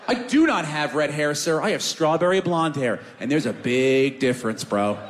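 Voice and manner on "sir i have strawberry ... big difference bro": normal talking voice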